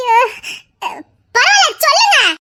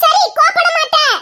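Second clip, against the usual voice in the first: higher, fast giggling plea; giggly pleading; 5 semitones higher than usual. Real, not acted. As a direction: coax him to tell, laughing. Wife pleads, mock reassuring husband. (சிரித்தபடி உயர்ந்த குரலில் கெஞ்சல்)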